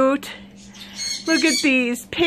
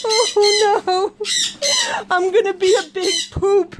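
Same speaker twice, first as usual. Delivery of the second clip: high squeal of laughter, then higher words; laughing dismay; 5 semitones higher than usual. Real, not acted. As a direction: wail it while cracking up. Cracking up at poop outfit.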